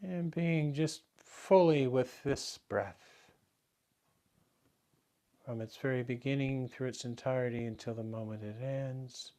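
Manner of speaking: soft, unhurried meditation guidance with long pauses; guided meditation. Real, not acted. Teacher guiding the class to relax into the breath, soft meditation voice. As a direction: settle them quietly into the breath, softly.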